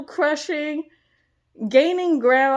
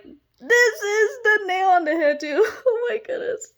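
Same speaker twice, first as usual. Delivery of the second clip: overwhelmed joy spilling over; laughing through tears; 9 semitones higher than usual. Real, not acted. Overcome, laughing and tearful.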